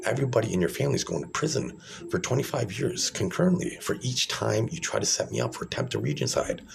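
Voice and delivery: ordinary talk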